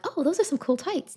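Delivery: high, sugary mock onlooker; mock complimenting voice; high fake compliment. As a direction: chirp it like a fake-nice passerby. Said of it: "They're like".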